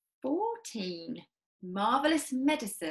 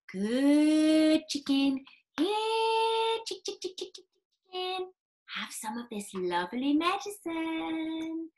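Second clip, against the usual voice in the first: coaxing; 7 semitones higher than usual. Acted. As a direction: tempt the bird sweetly. George coaxes the cockerel.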